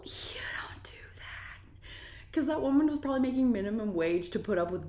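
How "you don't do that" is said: slow, emphatic whisper; whispered reproach. Real, not acted. Reacting to somebody being unreasonable, whispered disapproval. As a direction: drop to a drawn-out whisper.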